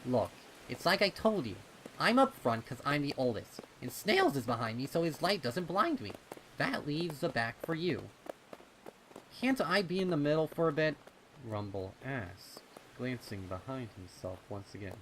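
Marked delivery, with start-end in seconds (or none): 0.0-8.1 put-on high, loud bossy kid voice; older colt voice. Snips explaining, older colt. 9.4-11.0 put-on high, loud, fast kid plea; pleading colt. Rumble pleading.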